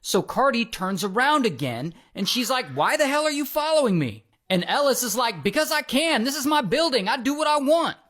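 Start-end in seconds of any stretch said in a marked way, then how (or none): 2.7-4.2 high-pitched indignant mock demand; retold outrage, voice pitched up; imitated angry confrontation. Acted quarrel, confronting a follower. 5.4-8.0 high, sassy mock retort; retold defiance, pitched up; imitated defiant comeback. Acted defiant comeback in quarrel.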